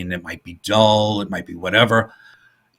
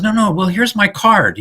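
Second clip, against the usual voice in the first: eager insisting; 10 semitones higher than usual. Retold or imitated. Man pleading with Bud.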